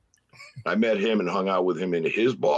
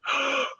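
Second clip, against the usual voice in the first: sharp gasp; 3 dB quieter than usual. Retold or imitated. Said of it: "And I was like".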